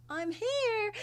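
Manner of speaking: put-on high eager child calling; little girl voice. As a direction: pipe up like a small girl. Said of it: "and I go".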